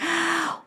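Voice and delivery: sharp, high mock gasp; dismayed gasp. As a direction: gasp as if wounded. Acting hurt at disappointment.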